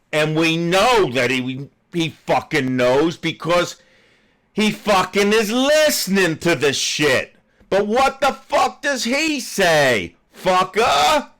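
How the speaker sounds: ranting at a politician, ending in a shouted insult; building fury. Real, not acted. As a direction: let the anger boil over. Ranting at evasive Secretary.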